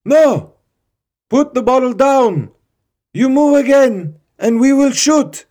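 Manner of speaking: high, very loud commands throughout; frantic shouted orders. Acted. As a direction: scream the orders to stop him. Guards shouting final orders.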